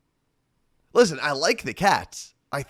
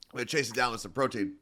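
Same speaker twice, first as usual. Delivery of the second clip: ordinary talk, nothing marked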